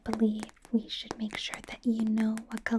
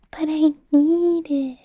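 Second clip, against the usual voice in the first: mock pleading; 6 semitones higher, 12 dB louder, slower than usual. Retold pleading with clerk.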